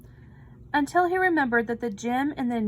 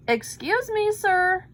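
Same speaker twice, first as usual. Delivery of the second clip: polite call; 8 semitones higher, 4 dB louder than usual. A narrator's reading. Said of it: "said Louis".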